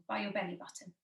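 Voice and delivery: ordinary talk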